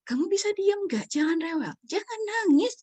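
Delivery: high-pitched, exasperated mom voice; scolding mother. Retold or imitated. Mother to fussy child in front of guests, mock scolding. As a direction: imitate a mom ordering her child to hush. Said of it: "Kemudian si ibu bilang gini".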